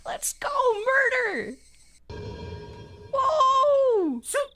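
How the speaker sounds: high, loud goofy yells; silly hype shouting. Real, not acted. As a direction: shout like a hyped fan.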